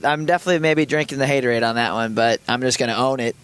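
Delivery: normal talking voice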